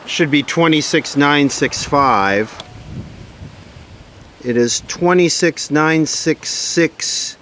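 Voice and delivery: normal talking voice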